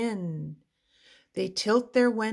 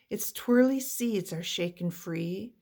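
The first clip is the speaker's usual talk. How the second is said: usual speaking voice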